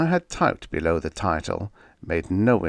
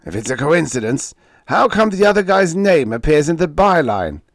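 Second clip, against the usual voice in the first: mocking editor; 8 semitones higher, 8 dB louder than usual.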